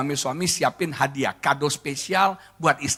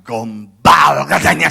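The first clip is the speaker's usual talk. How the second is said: mock scornful exclamation; 9 dB louder, slower than usual. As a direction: blast it out in imitated disbelief. Wife rejects sweet talk.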